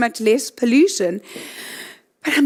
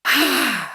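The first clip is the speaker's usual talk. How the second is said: open, letting-go sigh; demonstrated full exhaling sigh; big releasing sigh. Shown as a demonstration. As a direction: sigh it all out. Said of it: "let's just do it again".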